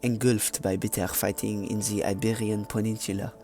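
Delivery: put-on muddled French-German accent; mock French narration drifting toward a German accent; French accent slipping into German. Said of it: "I'm going German, I don't know what the hell is this accent".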